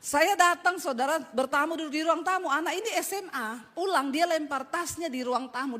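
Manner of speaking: normal talking voice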